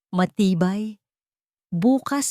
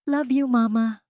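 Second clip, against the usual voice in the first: put-on sweet child voice; 7 semitones higher than usual. A narrator's reading. Little girl hugging her mother tight, affectionate.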